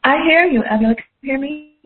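caller on speakerphone, echoey; thin phone line with echo; phone speaker. Real, not acted. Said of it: "There's a bit of an echo".

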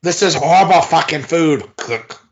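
put-on a failed British accent, loudly berating the cook; Gordon Ramsay. Mocking a wrestler as an angry TV chef, British rant. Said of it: "looks like clean shaven is Gordon Ramsay".

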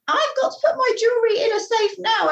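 put-on high, insistent old-lady voice; demanding New York lady. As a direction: mimic a pushy rich passenger. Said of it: "old ladies from New York who would just be like".

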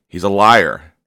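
loud, insistent, repeated for force; emphatic declaration. Real, not acted. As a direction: call it out with real conviction. Warning listener against the enemy.

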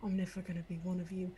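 low, hushed, quick grumble; quiet mutter. Acted. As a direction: mutter it fast under your breath. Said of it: "quietly mutter in Dwarven, being like".

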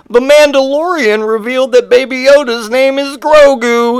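high-pitched gushing announcement; mock fan excitement. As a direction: squeal trivia like huge news. Acted breathless fan delivering news.

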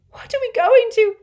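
very high, loud frightened question; panicked. A narrator's reading. Hermione to Harry in the atrium, panicked. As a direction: gasp it out in fear.

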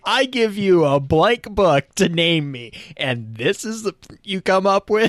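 high, slow put-on outrage; playfully scandalised, high-pitched complaint; mock indignation. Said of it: "just like, she's like".

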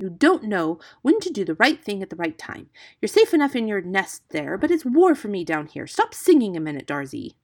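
loud, urgent scolding; exasperated. A narrator's reading. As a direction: tell off a heedless friend. Hero scolds the singing bird.

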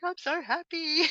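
high, drawn-out imitation of an overjoyed child; mock happy tears. Mother retelling her daughter's answer to a hug, imitated happy tears. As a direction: voice a daughter overcome with happiness. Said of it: "it was happy tears".

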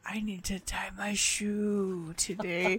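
slow breathy whisper. Pretend. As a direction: whisper it slowly. Mock [name] aside.

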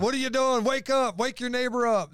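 high-pitched rousing call; urgent wake-up call. Acted. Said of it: "That's behold".

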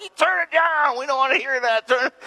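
put-on high, childish shout at the neighbors; high mock-kid protest; kids yelling at neighbors. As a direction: pipe up like annoyed kids. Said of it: "We're like".

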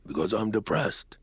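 slow, heavy royal voice; a rusty old king warming up; sleepy old king. Acted. Waking king talks to the boy.

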